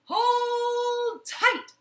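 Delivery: high, loud shout of the magic words; yell. Acted. Peter yells the magic words at the goose, loud high shout. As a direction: belt out the spell. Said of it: "And Peter yelled".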